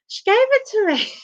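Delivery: put-on high-pitched, loud childlike protest; protesting child. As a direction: plead innocence to mum in a high voice. Child protesting to mum, retold.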